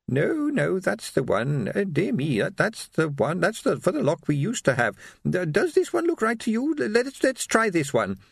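high, faltering querulous voice; dithering old lady. Acted. Old shopkeeper trying key after key, dithering. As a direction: stumble over words in a high old voice.

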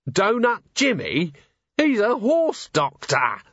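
high, slow outraged disbelief; aghast, drawn out in shock; incredulous. Acted. Shocked noble protests.

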